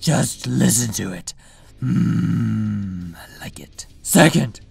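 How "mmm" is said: long, low, soft raspy hum; self-satisfied hum. Acted. Batman listening to his own voice, low pleased hum. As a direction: savour it with a drawn-out "mmm".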